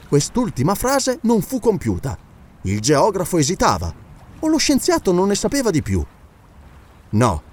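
plain reading voice

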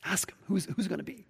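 unvoiced whisper, leaning in close. Peter secretly whispering to John, a soft whisper. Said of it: "Peter leans into John".

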